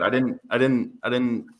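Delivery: stammering, repeating words nervously; nervous stutter. Real, not acted. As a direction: trip over the words. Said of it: "I'm stuttering 'cause I'm nervous".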